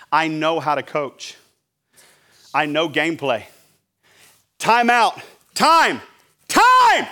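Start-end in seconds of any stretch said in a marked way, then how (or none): 4.6-7.1 calls building to a high shout; yelling. Acted. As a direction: yell for a timeout, higher and louder each time. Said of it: "I'm yelling time".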